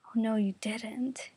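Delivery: slow, whispered offence; indignant whisper. Acted. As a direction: hiss it back, affronted. Taking offence at an insult.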